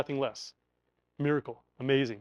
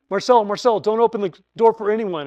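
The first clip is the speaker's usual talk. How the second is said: urgent warning; 5 semitones higher, 6 dB louder than usual. Retold or imitated. Neighbor warns urgently.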